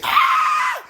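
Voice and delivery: sudden high startled shout; shocked yelp. Real, not acted. Speaker reacts to the app with a high shocked yelp. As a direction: jump out of your skin.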